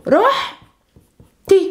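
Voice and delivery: رحتي drawn out slowly, "rooh-tee" with a throaty h; slow model word. Shown as a demonstration. Teacher repeating رحتي slowly for learners. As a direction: stretch رحتي so learners catch each sound. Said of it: "So what happens?".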